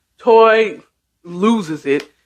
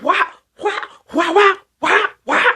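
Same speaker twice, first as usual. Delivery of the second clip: high, popping wah noises; imitated swats; 11 semitones higher than usual. Toy still swatting the mom-in-law, imitated swats. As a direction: fling out short high slap sounds. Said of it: "she's still like".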